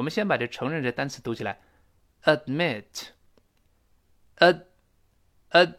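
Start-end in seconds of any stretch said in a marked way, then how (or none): 2.2-5.7 "ad" cut off with the tongue holding the d; very slow "admit", stopping dead after "ad"; held d. Shown as a demonstration. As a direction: break "admit" down, breath blocked on the d. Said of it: "注意舌尖顶住憋住气".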